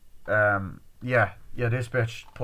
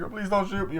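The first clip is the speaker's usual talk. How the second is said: high-pitched mock begging; frightened plea; 10 semitones higher than usual. Girl at gunpoint begging, high frightened plea. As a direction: beg for your life, half acted.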